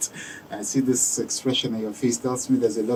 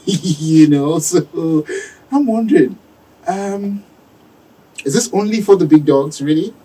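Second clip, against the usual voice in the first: laughing; 5 semitones higher, 7 dB louder than usual. Real, not acted. Teasing question about cost.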